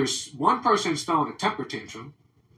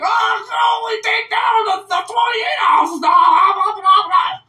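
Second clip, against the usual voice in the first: very high, loud, slowed blustering spokesman; mock official shouting; 14 semitones higher, 5 dB louder, slower than usual. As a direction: ham up a pompous bigwig drowning on. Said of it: "they're like".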